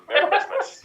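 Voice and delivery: put-on a laughing, overdone German accent; bad German accent. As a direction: cap off the joke with a hammed-up German accent. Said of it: "doing a terrible German accent".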